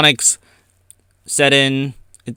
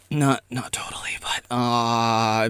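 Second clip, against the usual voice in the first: sudden breathy whisper; whispered hedge; 4 semitones lower, 8 dB quieter, slower than usual. Real, not acted. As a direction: drop to a whisper mid-thought. Sudden hushed aside.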